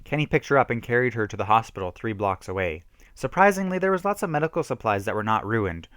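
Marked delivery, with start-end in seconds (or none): none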